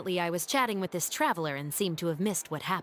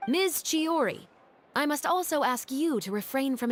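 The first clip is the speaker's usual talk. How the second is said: a higher, mock-stern opening; formal reproach; 7 semitones higher than usual. Officer formally admonishing Chiori.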